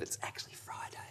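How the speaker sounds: quick whispered aside; whispering. Real, not acted. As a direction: let the truth slip out in a whisper.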